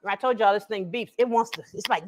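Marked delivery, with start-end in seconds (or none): none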